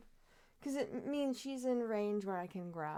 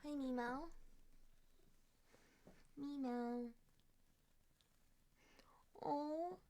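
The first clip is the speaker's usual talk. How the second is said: higher, softer coo calling the kitten; 5 semitones higher, 6 dB quieter, much slower than usual. Real, not acted. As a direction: softly sing out the cat's name. Calling cat by pet name.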